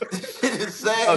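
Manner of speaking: amused, drawn-out lament; laughing. Real, not acted. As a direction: laugh out the words in disbelief. Laughing at own team's play.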